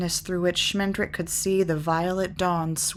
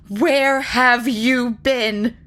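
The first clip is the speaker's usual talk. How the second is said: slow, loud, higher scolding; 4 semitones higher, 6 dB louder, much slower than usual. Acted. Molly scolds the unicorn like a strayed milk cow, slow and loud. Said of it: "scolding her as though she were a strayed milk cow".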